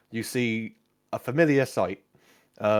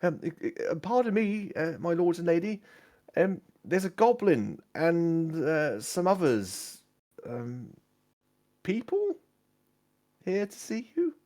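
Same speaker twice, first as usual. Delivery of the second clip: high-pitched, hesitant squire; nervous young servant interrupting his betters; 8 semitones higher than usual. Acted. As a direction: stammer timidly, higher, with halting pauses. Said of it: "a young squire enters your tent".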